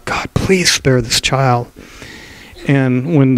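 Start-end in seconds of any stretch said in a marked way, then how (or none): 0.1-1.7 pleading prayer. Acted. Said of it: "he pleaded with God".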